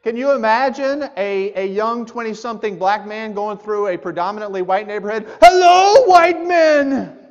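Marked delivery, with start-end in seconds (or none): 5.4-7.2 high, loud yelled hello; mock shouted hello; shouted greeting. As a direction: call it out across the street. Reenacting greeting in neighborhood.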